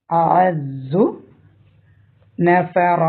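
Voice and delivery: drawn-out chanted അഅസ്സു; slow Quran recitation. Shown as a demonstration. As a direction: recite അഅസ്സു very slowly with a stretched vowel. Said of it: "tajweed recitation demo".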